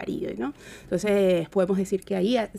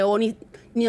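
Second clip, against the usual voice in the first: normal talking voice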